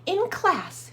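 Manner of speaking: high, loud chirpy greeting; chirping bird. Acted. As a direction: chirp hello like a little bird. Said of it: "she chirped".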